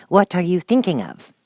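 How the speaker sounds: high, loud, scolding; stern Mouse. Acted. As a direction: demand an answer crossly. Mouse voiced.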